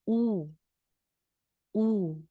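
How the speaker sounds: U with small rounded lips; rounded U demo. Shown as a demonstration. Teacher shows U with a small mouth opening, rounded demo. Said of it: "Malit lang po yung butas ng bibig".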